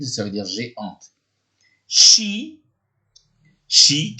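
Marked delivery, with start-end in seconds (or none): 1.9-4.2 She said much higher and louder; 1 high word demo. Shown as a demonstration. Teacher calling out the word She to the class. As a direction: pitch She up high and bright. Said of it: "le pronom personnel elle".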